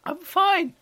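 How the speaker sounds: high-pitched protesting I'm fine; insistent I'm fine. Acted. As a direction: squeak it out to insist.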